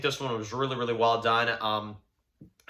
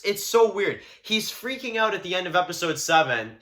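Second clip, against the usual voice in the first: ordinary delivery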